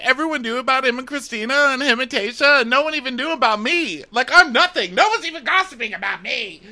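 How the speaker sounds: very high, wailing imitation of her; mock crying. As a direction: wail it as a hammed-up weepy complaint. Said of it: "she's crying to us".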